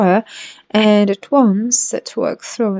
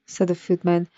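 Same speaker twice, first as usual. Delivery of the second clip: usual speaking voice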